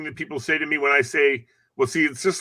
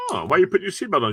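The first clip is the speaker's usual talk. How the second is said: fast, higher surprised question; fast, lightly raised question to his passenger; quick quoted question; 6 semitones higher, much faster than usual. Retold or imitated. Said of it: "I said".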